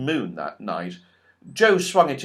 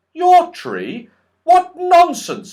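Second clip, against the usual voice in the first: much higher, loud indignant Joe; indignant boy; 10 dB louder, slower than usual. Acted. Joe scoffs at the goblins, loud and indignant.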